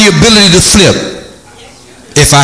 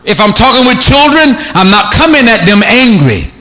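ordinary talk

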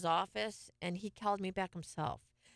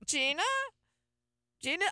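laughing call; 9 semitones higher, 4 dB louder than usual. Real, not acted. Calling the producer, laughing.

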